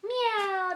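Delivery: high kitty meow; playful high cat imitation. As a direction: mew like a cat. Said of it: "That's a kitty".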